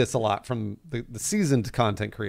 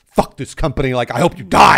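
louder imitation of an angry troll; mock hateful yell; 9 dB louder than usual. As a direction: bark it like a hateful online troll. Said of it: "you're out there being like".

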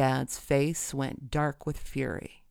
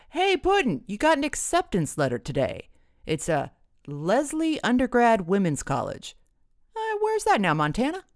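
high, swooping dad voice; chirpy dad; 8 semitones higher, 4 dB louder than usual. A narrator's reading. Dad announces daughter's acceptance letter, high and swooping. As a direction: lift it high, then tail off into a question.

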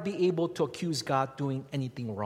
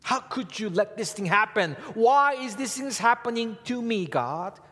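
high, loud whiny complaint to God; loud whining plea at God; mock complaint; 9 semitones higher, 7 dB louder than usual. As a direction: play the grumbling believer. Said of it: "That's what we complain".

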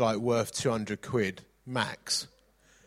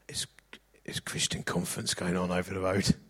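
hushed, conspiratorial whisper; whispered aside; 3 semitones lower, 3 dB quieter than usual. Retold or imitated. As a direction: lean in and whisper it like a secret. Waiter confides about the conference.

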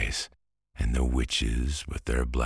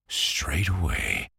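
plain reading voice

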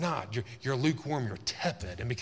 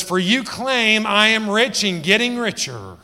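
much higher and louder, slow and exaggerated; loud mocking boast; 9 semitones higher, 11 dB louder, slower than usual. Preacher mocking the Laodiceans' boast, loud and high. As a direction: ham up their bragging.

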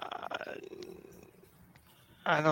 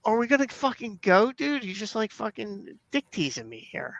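high, whiny frustration; mock exasperation; 8 semitones higher than usual. Co-host replaying his frustration with his friend, high and whiny. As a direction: whine at the friend.

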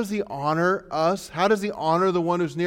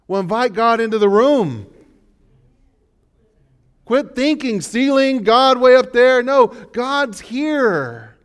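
louder, higher preaching voice; impassioned preaching; 8 semitones higher, 9 dB louder than usual. Acted. Pastor urging the congregation to invite God near. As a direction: press the point hard on the crowd.

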